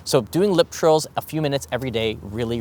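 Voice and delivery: ordinary talk, nothing marked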